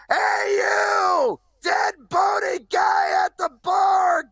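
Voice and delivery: high loud pirate holler across the bar; calling from far away. Acted. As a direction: call out like a pirate to someone far off. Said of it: "I gotta yell over at my friend".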